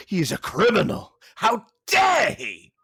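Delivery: hammed-up, scandalised cry; mock outrage. Mocking people offended by accents.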